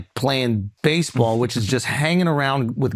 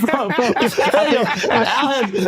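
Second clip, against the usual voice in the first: ordinary delivery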